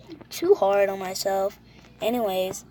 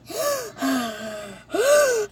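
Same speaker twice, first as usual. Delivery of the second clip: loud high gasp after holding breath; big gasp for air; 10 semitones higher, 4 dB louder than usual. Real, not acted. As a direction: gulp in air, high and sharp. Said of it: "hold my breath challenge".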